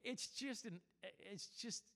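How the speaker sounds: high, soft, halting reply; flustered stammer. Retold or imitated. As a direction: fumble softly for an answer. Acts out fumbling reply to angry man.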